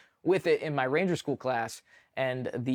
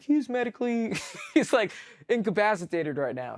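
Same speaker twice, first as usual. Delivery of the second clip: higher voice breaking into a laugh; 8 semitones higher than usual. Real, not acted. Telling how he'd cover for a sleeping soldier, laughing. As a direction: laugh through the excuse. Laughs mid-way through his excuse.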